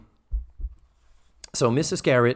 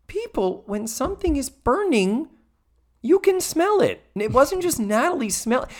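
much higher incredulous squeak; incredulous rant; 12 semitones higher than usual. Real, not acted. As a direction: pitch way up in disbelief. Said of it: "own rant, voice squeaks up".

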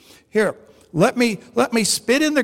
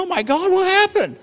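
high-pitched, amused alarm; astonished question; 8 semitones higher than usual. Retold or imitated. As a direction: ask it in mock shock, pitched way up. Retold shock at losing himself.

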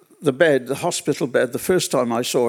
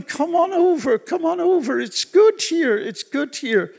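much higher, urgent calling across the river; mock shouting father; 13 semitones higher than usual. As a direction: call her over from the far bank, pitched way up. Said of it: "And he was shouting".

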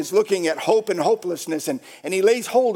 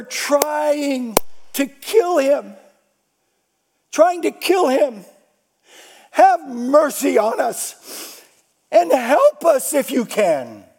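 raised, loud pleading as a distraught father; desperate father; 6 semitones higher, 4 dB louder than usual. Retold or imitated. Father begging Jesus for his son, loud desperate plea. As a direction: plead hard with force, stretching the words. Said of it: "the father is explaining the situation".

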